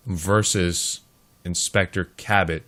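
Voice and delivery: ordinary talk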